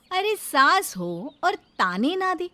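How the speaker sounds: usual speaking voice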